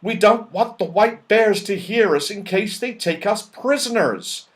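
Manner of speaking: anxious friend giving orders; Moonface urgent. Acted. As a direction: urge the others to keep it down, tense. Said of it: "said Moonface".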